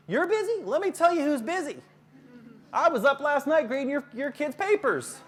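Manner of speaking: loud, high, combative retort; outraged one-upping, nearly shouting; indignant teacher outburst. Acted. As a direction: act out a teacher losing it at a parent. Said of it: "Instead of saying".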